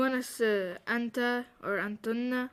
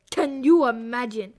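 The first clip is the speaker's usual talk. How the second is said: laughing; 11 dB louder than usual. Real, not acted. Echoing teacher while laughing.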